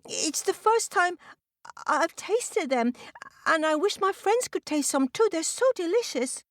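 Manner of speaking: very high, hesitant turtle voice; timid little turtle. Acted. Turtle explains herself to angry crow, timid pleading. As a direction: plead innocence in a small high voice.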